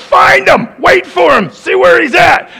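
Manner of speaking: high, loud mock rage; the heated thought taking over; angry inner voice. Pastor voicing his angry thoughts, loud. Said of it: "my mind goes".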